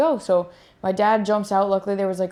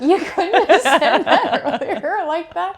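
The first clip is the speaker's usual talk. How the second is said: laughing; 9 semitones higher, 5 dB louder, slower than usual. Real, not acted. Laughing at the mix-up.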